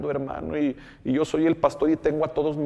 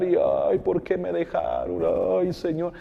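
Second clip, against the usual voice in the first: higher, whiny lament, hammed up; mock weeping; 5 semitones higher than usual. Pastor mimics himself crying to God, mock tearful. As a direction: imitate his own sorrowful crying to the flock. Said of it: "Me pongo a llorar".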